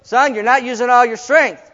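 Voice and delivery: father repeating himself; emphatic father. Retold or imitated. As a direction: repeat it as the stubborn dad. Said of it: "He comes".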